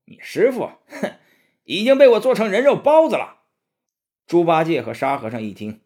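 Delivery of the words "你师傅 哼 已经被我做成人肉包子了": much higher and louder, a sneering monster voice; gloating demon. A narrator's reading. Demon taunting the disciples about their master, loud gloating. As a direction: taunt them with cruel glee. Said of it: "妖怪哈哈大笑道".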